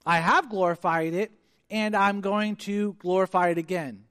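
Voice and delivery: loud, booming proclamation; thunderous voice from heaven. Acted. Preacher voices God answering Jesus' prayer, thunderous. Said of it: "this thunderous voice from heaven comes and says".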